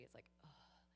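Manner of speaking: breathy whispered hopelessness; whispered despair; hopeless sigh. Shown as a demonstration. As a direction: let it sink into despair. Feeling into hopelessness.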